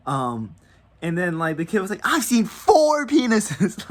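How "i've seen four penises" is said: loud, high, drawn-out boast; hammed-up imitation of a kid bragging. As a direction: crow it like a boastful young boy. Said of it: "that's something to brag".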